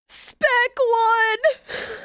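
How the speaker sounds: loud, bright excited greeting; excited call. Real, not acted. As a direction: call out the name with delight. Greeting a late chatter.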